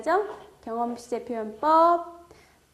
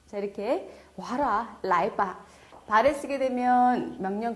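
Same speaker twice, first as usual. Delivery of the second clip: ordinary talk, nothing marked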